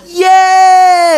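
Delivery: high, loud drawn-out cheer; kids losing their minds. Acted. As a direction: cheer like a frenzied classroom of kids. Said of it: "those kids lost their minds".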